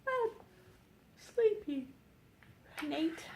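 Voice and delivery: a hint of a tired little girl; sleepy girl. A narrator's reading. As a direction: mumble it half asleep. Elizabeth sleepy.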